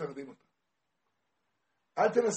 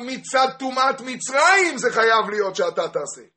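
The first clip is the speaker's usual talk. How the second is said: high, loud, impassioned declaiming; full-force preaching; 9 semitones higher, 14 dB louder than usual. Acted. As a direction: preach it at the top of your voice. (דרשה נלהבת בצעקות)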